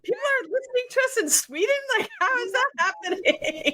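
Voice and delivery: high, fast astonished squeal; delighted shock at Swedish listeners; amazed disbelief. Retold or imitated. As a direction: gasp it out, unable to believe it. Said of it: "I'm like".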